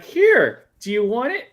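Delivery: high, loud teasing taunt, dangling the prize; singsong tease of holding it just out of reach; mock teasing offer. Taunting a fan who reaches for hope, high and loud. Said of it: "It's like".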